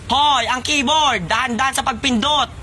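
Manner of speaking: high, drawn-out reprimand; scolding. Acted. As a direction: call out sharply to careless students. Sir scolds students at keyboard.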